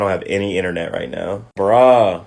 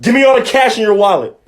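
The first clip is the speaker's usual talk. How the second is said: high, loud mock robber bark; mugger demand; 12 semitones higher, 6 dB louder than usual. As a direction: shout the holdup like a jumpy robber. Mugger skit demanding cash.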